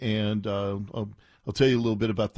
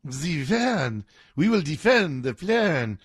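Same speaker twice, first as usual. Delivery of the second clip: fake French accent; 3 semitones higher, slower than usual. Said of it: "Is that your French accent?".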